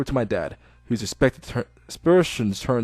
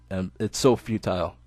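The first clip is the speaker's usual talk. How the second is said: ordinary delivery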